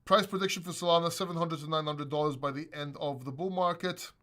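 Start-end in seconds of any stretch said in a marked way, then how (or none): none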